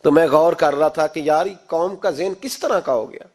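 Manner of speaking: ordinary talk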